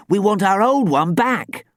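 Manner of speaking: high, loud, insistent demand; emphatic plea; exclaimed protest. A narrator's reading. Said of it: "Dan exclaimed".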